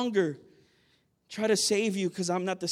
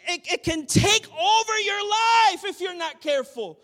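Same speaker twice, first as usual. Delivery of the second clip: loud, high preacher's shout; shouted warning; 10 semitones higher, 4 dB louder than usual. Real, not acted. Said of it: "shouted warning".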